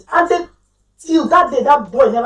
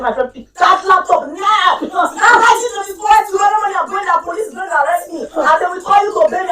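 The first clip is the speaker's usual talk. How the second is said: high, raised orders to the husband; mother ordering her husband to write to the school, raised and bossy; commanding; 6 semitones higher than usual. Retold or imitated. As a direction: bark the orders, half joking.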